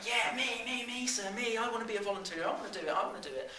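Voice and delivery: very high, eager pleading that tumbles along; clamouring schoolchild. Acted. Kids all beg teacher to pick them, excited clamour. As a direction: beg to be picked, high and urgent.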